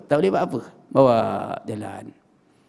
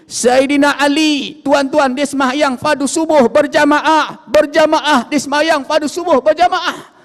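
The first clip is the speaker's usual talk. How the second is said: raised, high preaching voice; impassioned sermon delivery; fiery preaching; 9 semitones higher, 4 dB louder than usual. Acted. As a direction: preach it out loud to the congregation. Sermon opening at full force.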